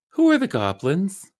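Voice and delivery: high, slow mock-clueless question; imitated puzzled mother. Co-host voicing mother in bed, high mock-puzzled question. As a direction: ask it slowly, high, rising at the end. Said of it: "she goes".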